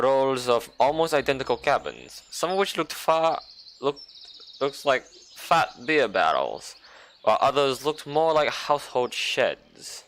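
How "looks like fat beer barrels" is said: normal talking voice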